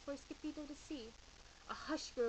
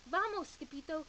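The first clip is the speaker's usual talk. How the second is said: loud cry; 2 semitones higher, 7 dB louder than usual. Acted. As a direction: shout the warning. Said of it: "they cried".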